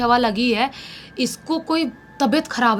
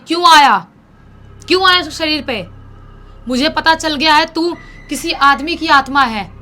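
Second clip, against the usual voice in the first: loud, higher, stern exorcist's shout; commanding exorcist; 5 semitones higher, 7 dB louder than usual. Acted. Village exorcist demands why the spirit entered her, loud stern shout. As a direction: interrogate the spirit with booming authority.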